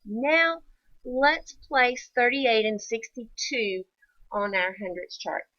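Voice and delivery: ordinary talk, nothing marked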